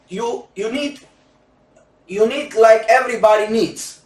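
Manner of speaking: usual speaking voice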